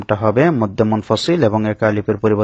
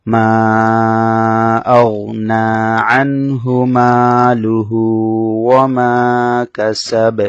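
slow, drawn-out melodic tajweed recitation; slow sung Quranic tilawa; 3 dB louder, much slower than usual. Shown as a demonstration. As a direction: chant each word long and measured. Recites the verse.

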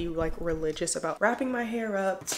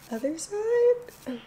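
airy, slow and high, mock-refined influencer; parodying the very demure trend, high and slow; TikTok demure voice; 6 semitones higher, 3 dB louder, much slower than usual. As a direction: draw out each word like a prim TikTok trend. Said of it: "we start talking like this".

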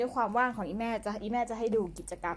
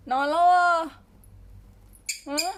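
much higher and louder sing-song question; high cooing call; 7 semitones higher, 8 dB louder, slower than usual. Real, not acted. Owner calling to the sleeping pet, high cooing question. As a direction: coo up at the pet in the nest.

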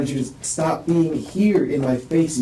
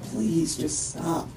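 slow, softer begging; almost pleading under his breath; soft plea; 3 semitones higher, 6 dB quieter, much slower than usual. Retold or imitated. Man recalling his plea as she stayed in his face, soft and slow. Retold quiet plea in the fight.

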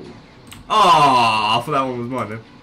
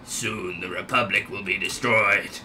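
lower, softer evil overlord voice; Sidious; 6 semitones lower, 5 dB quieter than usual. Pretend. Gamer retrying the Star Wars villain, low soft menace. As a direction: threaten quietly like a dark lord. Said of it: "I'm trying to come with Sidious".

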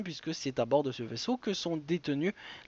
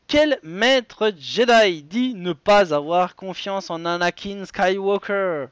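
emphatic, measured game-show question read; raised, punchy presenter delivery; quiz host announcing; 4 semitones higher, 6 dB louder than usual. Acted.

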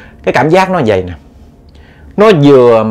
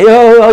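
choked moan; 8 semitones higher, 6 dB louder than usual. Retold or imitated. Imitating the uncle's choking.

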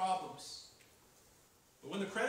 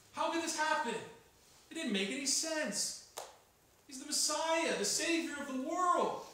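higher and louder, crying out; anguished disbelief; 9 semitones higher, 6 dB louder than usual. Acted. Preacher voices the disciples' dismay with a loud cry.

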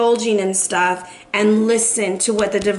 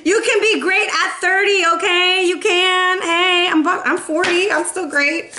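high-pitched, laughing reassurance; amused; 7 semitones higher than usual. Real, not acted. Reader cheering on listeners about their age, amused. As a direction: say it higher, straight out of a laugh. Joking about being great at thirty.

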